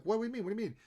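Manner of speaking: high-pitched, startled questioning; mock alarm. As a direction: imitate someone taken aback. Acted startled reply to director.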